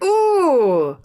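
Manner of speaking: high, drawn-out mock recoil; retold uncomfortable "ooh", pitched high; awkward reaction. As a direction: imitate someone taken aback and uneasy. Man's awkward reaction, acted out.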